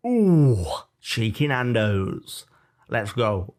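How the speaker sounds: big drawn-out ooh, slow relish; excited response. Acted. Asking co-host how he responds to Nando's, excited. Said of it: "Or do you go".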